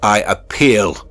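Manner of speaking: loud, drawn-out call; loud slow cry. A narrator's reading. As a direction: call out the appeal slowly and loud. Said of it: "Again I cried".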